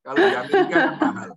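real laughing banter; laughing tease. Real, not acted. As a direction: tease her while laughing. Teasing joke to returning friend.

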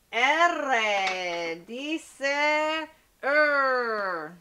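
R dice er, sounding the R slowly and drawn out; letter sound demo. Shown as a demonstration. (pronunciación lenta del sonido de la R)

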